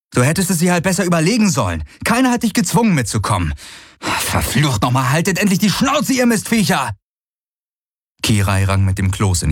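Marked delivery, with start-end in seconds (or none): 0.1-7.0 high-pitched, exasperated rant; angry outburst. Acted. He blames her, then curses the creatures, angry outburst. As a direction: lash out, rising to a yell at the end. Galen streitet, brüllt die Viecher an.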